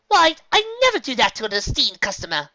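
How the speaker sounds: high, loud indignant lady; huffy elegant mare; Rarity voice. Acted. Rarity denies.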